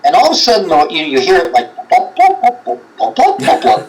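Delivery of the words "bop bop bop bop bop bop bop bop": high, laughing sing-song bops; mock water bopping, high and playful; sing-songy bop imitation. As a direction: bounce the bops up high like a little tune. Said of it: "like a little sing-songy thing".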